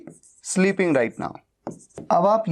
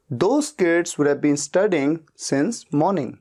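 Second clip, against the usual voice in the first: slow model sentence; much slower than usual. Shown as a demonstration. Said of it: "teacher models English sentence".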